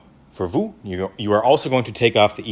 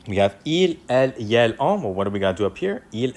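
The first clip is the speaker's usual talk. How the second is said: normal talking voice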